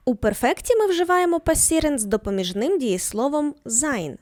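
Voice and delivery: ordinary delivery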